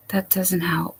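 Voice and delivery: soft unvoiced whisper to herself; whispered aside. Real, not acted. Streamer whispers to herself while fixing the panel, whispering. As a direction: breathe it out under your breath.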